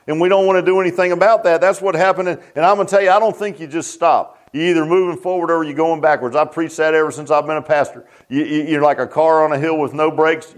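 normal talking voice

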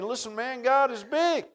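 put-on high, earnest boyish voice; boy telling soldiers God is bigger; young David. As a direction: reassure like a confident kid. David answers the army.

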